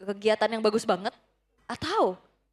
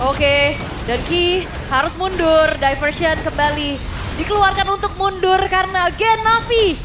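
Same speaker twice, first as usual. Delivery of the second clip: excited casting; 5 semitones higher, 6 dB louder than usual. Real, not acted. Retreat called live.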